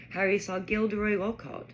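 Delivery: usual speaking voice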